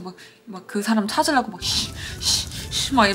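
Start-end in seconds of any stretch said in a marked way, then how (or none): 1.6-3.1 imitating a dad's furious nose huffs; breathy snorting huffs, half-mocked; mock angry huffing. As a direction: huff breath through the nose like an enraged father. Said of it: "코에서 바람 겁나 나오면서".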